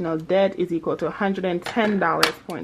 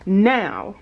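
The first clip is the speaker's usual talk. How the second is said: ordinary delivery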